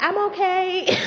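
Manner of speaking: high-pitched imitation of a kid calling out. Kid outside the window calling to teacher, high mimicking voice. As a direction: mimic a child's high, reassuring call.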